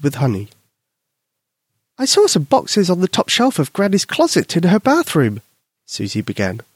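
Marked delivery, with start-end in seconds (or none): 2.0-5.4 a hint of a child's voice, higher; sweet little girl telling a secret; lightly voiced little girl. Little Susie to her invisible friend, lightly voiced girl.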